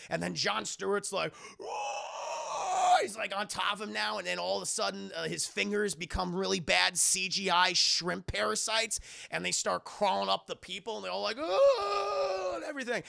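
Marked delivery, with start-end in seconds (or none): 1.6-3.0 long, very high strained roar; imitated monster roar. Retelling the villain attacking, imitated roar. 11.4-12.5 long, high, loud "oh"; imitated panicked cry. People crawled on by parasites, imitated cry.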